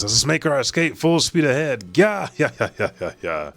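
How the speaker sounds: high, giddy pirate cheer; excited pirate. Acted. Pirate shouting to escape full speed, giddy. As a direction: whoop it up as you flee. Voicing pirate fleeing God Valley.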